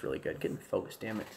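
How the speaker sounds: whispered, annoyed aside to himself; muttering. Real, not acted. Streamer cursing his blurry camera, whispered. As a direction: grumble it under your breath.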